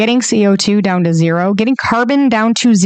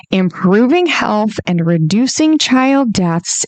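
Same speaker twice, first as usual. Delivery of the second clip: slow emphatic; 3 semitones higher than usual. Shown as a demonstration. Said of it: "go with me on this here".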